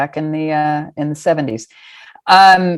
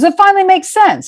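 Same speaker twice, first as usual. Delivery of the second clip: high excited imitation of Brenda; voicing Brenda; 5 dB louder than usual. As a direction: pitch up high and loud as the friend. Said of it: "She goes".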